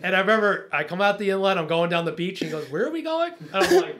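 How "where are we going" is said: puzzled Shark. Retold or imitated.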